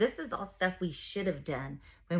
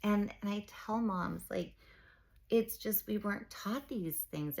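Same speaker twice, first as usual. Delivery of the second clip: ordinary delivery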